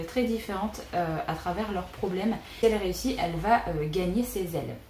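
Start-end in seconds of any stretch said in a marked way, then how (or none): none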